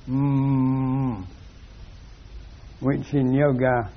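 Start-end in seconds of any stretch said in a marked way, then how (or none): 0.1-1.2 a sustained closed-lip mm chanted like a mantra; mantra hum. Shown as a demonstration. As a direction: hum it as a magic syllable. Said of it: "uttering the magic mantra".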